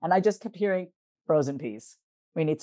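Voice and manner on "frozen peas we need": lightly voiced body part, low and soft; a quiet, lower little plea, imitating the neck; voice of aching neck. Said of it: "given each part of my body a voice".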